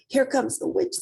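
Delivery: plain reading voice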